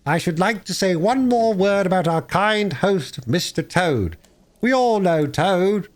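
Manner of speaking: high, slow, sneering toast; mocking speaker. Chief Weasel mocking Toad before the guests, high and slow. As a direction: drag out the mockery for laughs.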